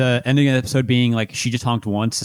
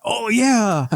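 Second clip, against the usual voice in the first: mock crowd; 10 semitones higher than usual. Mocking crowd reaction.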